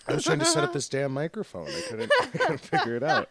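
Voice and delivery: talking through laughter; laughing. Real, not acted. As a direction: laugh out the words. Laughing at microphone trouble.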